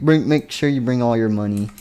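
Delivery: usual speaking voice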